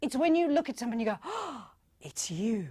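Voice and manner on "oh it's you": slow, gasping adoration; gasp, then draw it out in awed delight; delighted honouring. Acted. Honouring someone you look at, slow gasp of delight. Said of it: "your heart explodes with joy".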